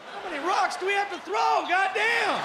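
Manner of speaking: high, loud exasperated outburst; exasperated shout. Real, not acted. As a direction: yell it in disbelief. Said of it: "exasperated rant".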